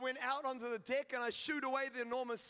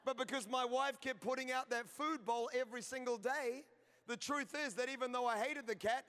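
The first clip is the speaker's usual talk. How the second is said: ordinary talk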